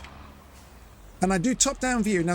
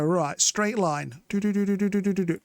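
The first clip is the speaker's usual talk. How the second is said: humming plough noise. Retold or imitated. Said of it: "and I'd go".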